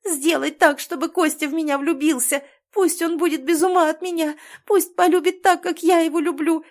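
high, loud, insistent pleading; tearful, shouted tantrum. A narrator's reading. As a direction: wail out demands like a lovesick teenager. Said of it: "плакала, кричала, топала ногами".

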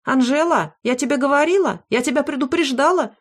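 a lightly voiced nagging granny who warned you; scolding grandma on phone. A narrator's reading.

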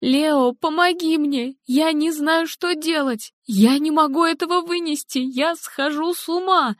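high, panicked pleading to her husband; frantic mother. Acted. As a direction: cry out for help, losing control. Helen begging Leo for help.

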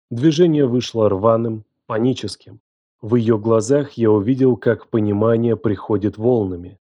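ordinary delivery

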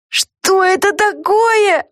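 high, slow frightened squeal. A narrator's reading. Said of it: "взвизгнула Люси".

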